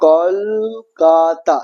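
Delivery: কলকাতা drawn out as kol-ka-ta; syllable by syllable. Shown as a demonstration. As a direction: give each syllable of কলকাতা its own long beat. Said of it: "এই যে তিনটা দল".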